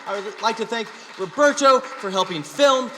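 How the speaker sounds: loud, high-pitched shouting; shouted sign-off. Real, not acted. Comic thanks everyone and ends the show, shouted sign-off. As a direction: belt out the thanks to the crowd.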